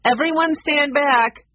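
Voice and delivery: high, loud, slow call; called-out warning. A narrator's reading. Woman about to drop dough in oil, calling out a warning. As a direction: call out a warning to the whole line.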